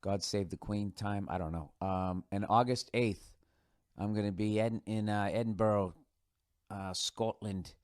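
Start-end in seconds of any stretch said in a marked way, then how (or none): none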